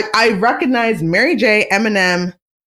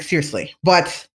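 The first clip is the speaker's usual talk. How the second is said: ordinary talk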